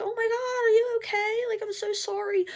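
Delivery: high, breathless panic; freaked-out teen apologizing to the driver she hit; frantic apology. Retold or imitated.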